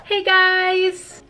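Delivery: bright high hello to viewers; excited greeting. Real, not acted. Vlogger greeting her viewers, high and eager. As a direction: launch the vlog with a high, eager hi.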